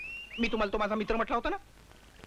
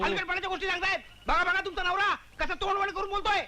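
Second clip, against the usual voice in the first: indignant, high-pitched retort; भांडखोर, चढा आवाज; 10 semitones higher than usual. Acted. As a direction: argue back with the pitch right up. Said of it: "हळू बोला, हो". (चढ्या आवाजात उलट भांडण)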